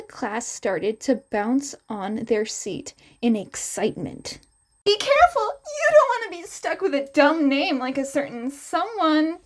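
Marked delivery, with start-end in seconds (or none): 4.8-9.4 high, bright-voiced teasing heroine; higher playful woman's voice; teasing Midnight. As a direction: tease the class playfully.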